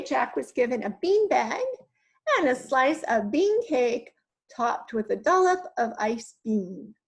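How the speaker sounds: ordinary delivery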